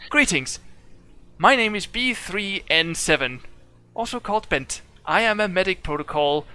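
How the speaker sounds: high, precise robotic self-introduction; formal robot medic. Acted. Warforged introducing itself to the party, high formal robot voice. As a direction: recite your designation like a proud machine.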